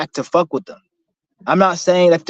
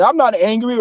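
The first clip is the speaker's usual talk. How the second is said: higher-pitched mock denial; playing it off; 6 semitones higher than usual. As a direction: lightly imitate someone brushing it off. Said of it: "try to play it off".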